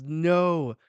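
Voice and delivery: loud firm refusal. Acted. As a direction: slam the answer down. Rox's flat refusal in the fight.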